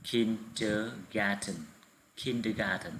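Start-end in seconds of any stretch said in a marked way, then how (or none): 0.0-1.5 very slow, syllable by syllable teacher model; deliberate drilling pace; slow model word. Shown as a demonstration. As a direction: draw out "Kindergarten" slowly so the class can copy it. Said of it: "Các em đọc nhấn vào âm thứ nhất".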